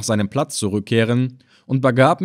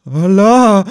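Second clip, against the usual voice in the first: higher, louder terrified Allah; the old man's second, louder cry of Allah; frightened cry; 9 semitones higher, 8 dB louder than usual. A narrator's reading. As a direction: cry out as lightning struck near you. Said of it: "rief er erschrocken aus".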